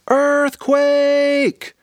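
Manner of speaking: high, loud cry of alarm; sudden high shout of earthquake panic; panicked shout. Acted. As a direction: yell it like the ground is shaking. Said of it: "Aaliyah shouted".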